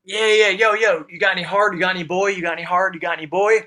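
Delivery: high, keyed-up hustle for a fix; eager calling out. Retold or imitated. Eager to buy from dealer.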